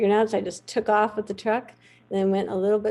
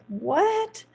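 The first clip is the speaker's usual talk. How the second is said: higher, incredulous "What? This is the vet?"; mock disbelief; 7 semitones higher, 5 dB louder, much slower than usual. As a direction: play the stunned cowboys doubting her. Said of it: "everybody there were just like".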